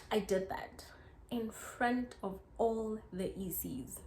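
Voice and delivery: hushed confession, barely voiced; whisper. Real, not acted. Whispering to viewers about crying before the E Cs. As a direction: breathe it out as a secret.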